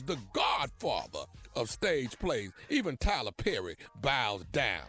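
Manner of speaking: ordinary delivery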